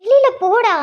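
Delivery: angry shout. Acted. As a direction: yell at him to leave now. Sister orders brother out. (கோபமான கத்தல்)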